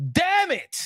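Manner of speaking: ordinary delivery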